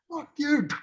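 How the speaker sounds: high mock kid yell; kid swearing. Two kids punching on the plane, high mock kid yell. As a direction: shoot it back like a brawling kid.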